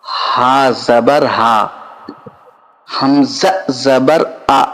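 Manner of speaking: slow, drawn-out chanting of letters for pupils; teacher reciting the alphabet slowly to children, syllable by syllable; slow letter recitation. Shown as a demonstration. Students learning Arabic letters, slow drill recitation.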